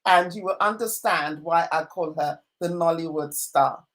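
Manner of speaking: normal talking voice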